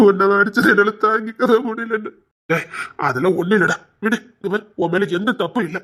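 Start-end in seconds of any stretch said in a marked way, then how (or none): 0.1-2.1 tearful confession of guilt; choked with remorse; crying. Acted. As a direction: admit it while sobbing. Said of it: "நீ ஏன்டா அழுதுட்டு இருக்கா".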